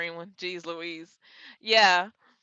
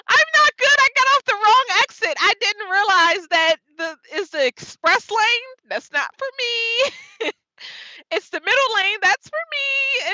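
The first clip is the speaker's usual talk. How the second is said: much higher, louder, half-crying whine; whiny near-tears reply; 10 semitones higher, 4 dB louder than usual. Retold or imitated. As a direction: whine to the mentor like a kid about to cry. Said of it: "I was like".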